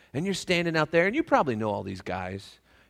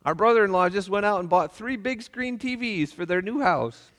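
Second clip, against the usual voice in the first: wife quoted; 8 semitones higher, 5 dB louder than usual. Retold or imitated. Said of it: "and she said".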